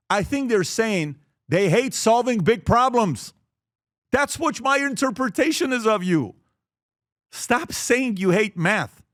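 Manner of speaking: slow, weighted preaching; slow emphatic plea. Real, not acted. Speaker urging parents to stop hating math, slow and firm. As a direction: lean on each word to convince.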